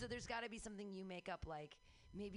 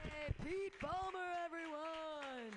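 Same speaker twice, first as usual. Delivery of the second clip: high, drawn-out host announcement; host hype; 8 semitones higher, much slower than usual. Real, not acted. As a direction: stretch the name out like an emcee cheering the crowd. Host cheering applause.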